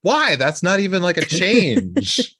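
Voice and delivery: high, baffled disbelief; incredulous. Real, not acted. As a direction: push back in disbelief, pitch up. Incredulous objection.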